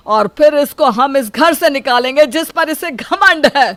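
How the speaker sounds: loud, high, furious vow; a father raging at his ungrateful son. Acted. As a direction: swear to throw him out, shouting. Father vows revenge.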